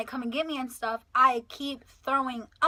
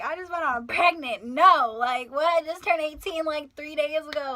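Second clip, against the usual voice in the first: loud, higher retold outburst; upset protest; 5 semitones higher, 7 dB louder than usual. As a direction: protest that you are not fine. Upset answer to doctor's question.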